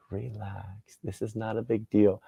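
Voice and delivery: soft, settling reassurance; calming self-talk. Acted. Driver telling himself to let it go, soft and calming. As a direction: drop the volume and soothe yourself.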